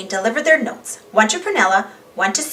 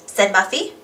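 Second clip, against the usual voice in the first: usual speaking voice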